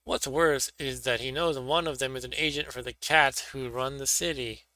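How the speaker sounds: usual speaking voice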